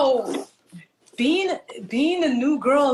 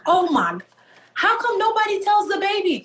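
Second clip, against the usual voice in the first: put-on high-pitched youngest-child complaint; mock indignant protest; 10 semitones higher than usual. Youngest child complains nobody told her, mock indignant protest. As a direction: protest like the baby left out.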